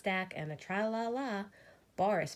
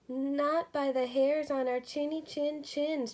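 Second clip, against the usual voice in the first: higher, mildly fearful voice; trembling reply; 8 semitones higher than usual. A narrator's reading. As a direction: answer bravely but scared. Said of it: "trembled Boris".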